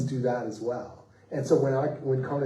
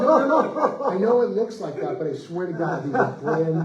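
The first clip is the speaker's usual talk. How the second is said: much higher, louder, laughing through the denial; laughing protest; 6 semitones higher, 6 dB louder than usual. Retold or imitated. Writer insisting to the doubting fan, laughing. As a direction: plead your case while cracking up.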